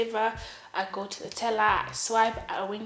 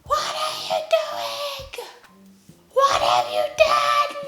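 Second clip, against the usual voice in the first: very high, drawn-out hysterical shriek; mock screech, loud and stretched out; imitated mother's yell; 10 dB louder, slower than usual. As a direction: shriek it slowly like a horrified mother. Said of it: "And then she yells".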